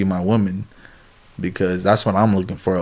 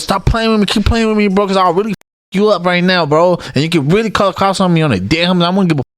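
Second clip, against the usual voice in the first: raised, heated mock threat; angry threat; 7 semitones higher, faster than usual. Man retells threatening his girlfriend, in heated mock anger. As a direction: snap back at her, fired up. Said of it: "I just lost it".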